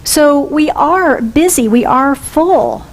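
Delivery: ordinary talk, nothing marked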